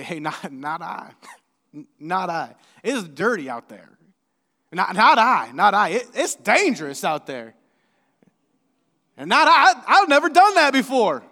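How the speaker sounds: reluctant refusals swelling to loud whines; barnyard animals refusing. Pretend. Reluctant barnyard animals refusing the pastor's call. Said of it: "like all of the, the barnyard animals".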